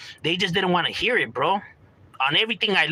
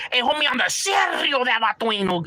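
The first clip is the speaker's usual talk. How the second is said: high, hammed-up gimmick homie voice; overdone homie talk; 9 semitones higher than usual. Shown as a demonstration. Showing how fake homies try too hard, overdone. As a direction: put on a forced, showy barrio swagger. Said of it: "it's not like".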